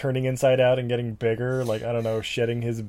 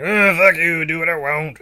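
put-on drunk angry shout; 4 semitones higher, 5 dB louder than usual. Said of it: "he's like".

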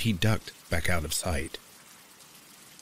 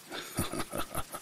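breathy sinister chuckle; menacing chuckle; 9 dB quieter than usual. A narrator's reading. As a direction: chuckle like a creepy stalker. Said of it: "a low, menacing sound".

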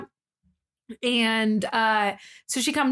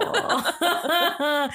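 laughing; 4 semitones higher than usual. Retold or imitated. Reacting to roommate moving in.

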